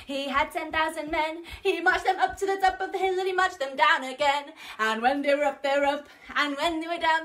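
rushed, breathless sung march; super fast. Shown as a demonstration. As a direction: race through the song. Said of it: "Let's go super duper fast".

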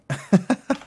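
short, high giggle; amused laugh. Real, not acted. Narrator tickled by an odd word, amused laugh. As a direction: laugh at a funny-sounding word.

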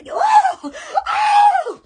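high laughing squeal; excited squeal. Real, not acted. Vlogger squealing at her Facebook reels, high and laughing.

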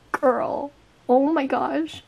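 usual speaking voice

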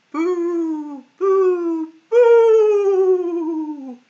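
high, loud ghostly boo; ghost shouting boo. Acted. Ghosts flying through the chimney, shouting high and loud. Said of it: "where the ghosts fly through shouting".

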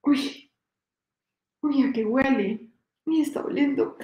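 lightly voiced old grandpa noticing a smell; a hint of a puzzled grandpa; grandpa sniffing. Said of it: "empieza el abuelito". (abuelito desconcertado por el olor)

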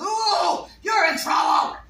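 high loud sing-song parrot shout; parrot warning. Retold or imitated. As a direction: warn them like a tattling parrot. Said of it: "he finally goes".

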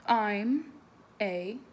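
lively, loud read-aloud voice; animated picture-book reading; story reading to children. Acted. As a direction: read it big for the kids.

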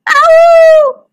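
high, loud yelping howl. Acted. Baby wolf howling at his brother, a high loud yelp. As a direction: let out a sharp wolf-cub howl. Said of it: "howled Smelly Breath".

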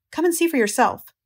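high, urgent plea; pressing him to come see; urgent and shaken. Acted. Lurvy urging, shaken.

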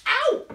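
sudden high, loud "ow"; comic yelp of pretend pain; mock pain yelp. Stretching a balloon for children, hammed-up pained yelp.

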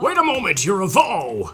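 hammed-up, high-pitched shock; shocked exclamation. Guard discovers Link is a man, hammed-up shocked exclamation. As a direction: cry out in mock astonishment, pitch leaping.

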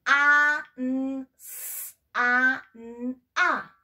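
"a, n, s, a, n, a" said as separate sounds; segmented letter sounds; sounded out. Shown as a demonstration. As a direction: spell each sound out for the child. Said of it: "es una mm".

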